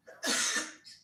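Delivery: a dry cough from a cold. Real, not acted. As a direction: cough it out, sick. Sick with a cold.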